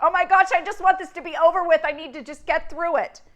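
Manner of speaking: frantic wish to be done; panicked thought. Retold or imitated. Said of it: "you thought".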